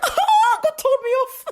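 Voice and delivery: high, loud excited squeal; delighted squeal. Retold or imitated. Telling listeners how God scolded her, high excited squeal. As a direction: squeal with giddy disbelief. Excited retelling of God's rebuke.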